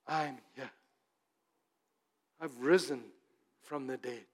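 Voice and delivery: lightly voiced tender Jesus; gentle voice of Jesus. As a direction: speak as a gentle risen Lord. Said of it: "He calls your name and he says".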